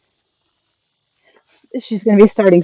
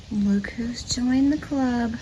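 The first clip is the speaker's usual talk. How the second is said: hushed whisper to a new arrival; 6 dB quieter, slower than usual. Real, not acted. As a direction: breathe it softly, slowly.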